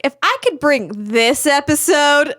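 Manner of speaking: slow, building proclamation; mock-triumphant declaration. Crew member proclaiming she can do anything, slow and rousing. Acted inner declaration of confidence.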